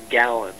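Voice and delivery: "Galen" as GAL-un, short a; mistaken pronunciation. Shown as a demonstration. Admitting an old mistake about the name, "Galen" as GAL-un. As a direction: say "Galen" to rhyme with talon. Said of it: "I thought it was Galen".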